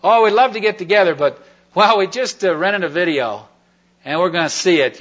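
lightly mocking the couple who declined; mocking excuse. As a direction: parody a flimsy excuse. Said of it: "what they replied to me".

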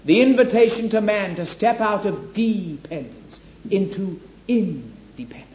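normal talking voice

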